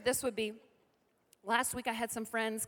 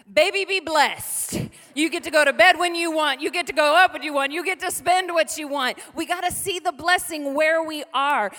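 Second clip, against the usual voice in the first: louder, climbing preacher's cry; full-force preaching; 3 semitones higher, 8 dB louder than usual. Acted. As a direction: preach it hard to the congregation. Preaching to singles and parents.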